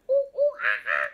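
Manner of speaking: high, loud monkey hoots; playful "ooh ooh ah ah" monkey noises; monkey call. Acted. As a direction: hoot like an excited monkey. Imitates the monkey just revealed.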